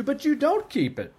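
very high, loud objection; incredulous protest. A narrator's reading. Nephew contradicting his uncle, high-pitched protest.

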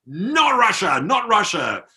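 high, loud retold objection; imitated angry outburst. Bald man objects to soldier, imitated angry outburst. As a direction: mimic a man banging the table. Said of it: "went bang on the table".

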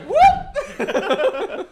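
very high, laughing whoop; mock squeal. Friend mocks the vegan woman's delight, high laughing whoop. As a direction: squeal it like she's thrilled. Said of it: "She's just like".

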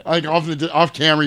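ordinary delivery

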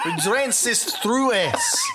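slow laughing mock Russian; Russian accent. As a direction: laugh through a Russian surgeon voice.

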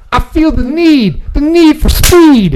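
hammed-up movie hero. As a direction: belt it like a cocky pilot. Acting out Top Gun line.

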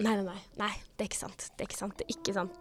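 soft, hushed repeated disbelief; muttered denial. Retold or imitated. Arguing with herself on the bus, muttered denial. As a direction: talk yourself out of it under your breath. Acted doubting inner voice.

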